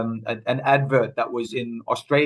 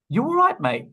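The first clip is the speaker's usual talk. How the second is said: lumberjack checking in; 11 semitones higher than usual. Acted. Lumberjack asks his pinned mate.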